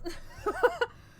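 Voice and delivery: high burst of laughter; loud laugh. Real, not acted. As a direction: crack up hard. Big laugh.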